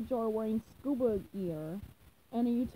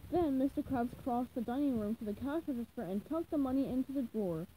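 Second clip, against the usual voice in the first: ordinary delivery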